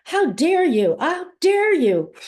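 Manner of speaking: high, loud indignant cry, stretched out at the end; pissed outburst. Shown as a demonstration. Mother confronting her absent daughter in a tapping demo, outraged. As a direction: build to outrage, pitch soaring and drawn out. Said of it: "I'm really pissed at you".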